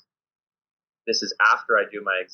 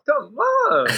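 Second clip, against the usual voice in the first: much higher, louder exasperated come on; mock exasperated; 4 dB louder than usual. Coach to new American team, mock exasperated higher plea. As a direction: throw up your hands in mock despair. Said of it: "I'm like".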